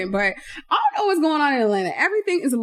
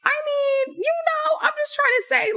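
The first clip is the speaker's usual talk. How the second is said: high whiny voice; 13 semitones higher than usual. Real, not acted. Playful high whine.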